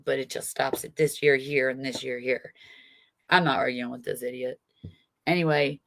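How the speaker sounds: ordinary delivery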